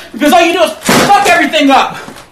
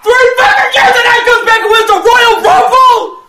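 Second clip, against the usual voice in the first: angry rant; 6 semitones higher than usual. Real, not acted. Man ranting in clip.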